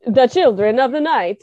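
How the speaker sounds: hammed-up Dracula vampire accent; imitating a Transylvanian vampire accent. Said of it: "You're like, the, yeah".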